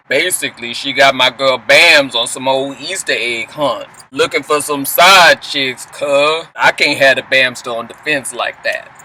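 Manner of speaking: low, slow drawled impression; Scrappy. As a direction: drop the pitch and drag the words out. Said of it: "Let me try my Scrappy voice".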